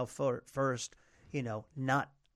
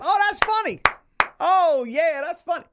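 very high, full-volume heckler yell; screaming heckler; 14 semitones higher, 11 dB louder than usual. Retold or imitated. Comic imitating a lady heckling the show, high loud screaming. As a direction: imitate a woman screaming from the crowd. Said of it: "Just kept screaming".

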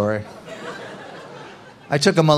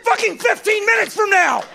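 much higher, shouted disbelief; ranting shriek. Acted. Comic ranting about kids temp coffee, much higher. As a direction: scream out the absurd idea. Climax of the rant.

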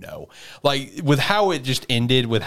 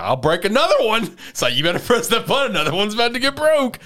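high, loud imitated threat; mock threatening yell; 12 semitones higher, 4 dB louder than usual. Yuichi demanding the captain press the button, mock yell. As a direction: shout the ultimatum, pitch way up and hammed.

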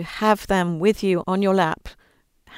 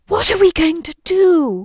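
high, louder gasp then a worried cry; little girl's gasp; 12 semitones higher, 6 dB louder than usual. Retold or imitated. Orphan girl hearing the story's end, gasping in alarm. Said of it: "she went".

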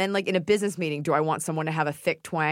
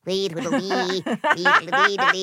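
munchkin; 4 dB louder, slower than usual. Shown as a demonstration. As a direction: squeal it like a munchkin. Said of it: "you sound like a munchkin".

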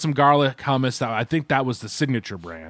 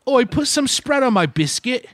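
higher-pitched hammed-up Brit; mock British accent; 9 semitones higher than usual. Host joins the joke about British spread, mock British accent. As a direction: play a cartoon Englishman asking for biscuits.

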